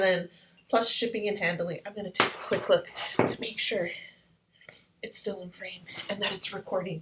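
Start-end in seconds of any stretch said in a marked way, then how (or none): 5.0-7.0 soft whisper to herself. Real, not acted. Checking the camera framing, whispering. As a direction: mutter it under your breath.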